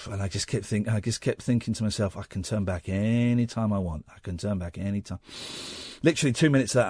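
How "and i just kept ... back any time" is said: low, soft inner voice; imitating calm self-talk; hushed self-reassurance. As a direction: talk yourself down quietly, under your breath. Retells calming self-talk during panic.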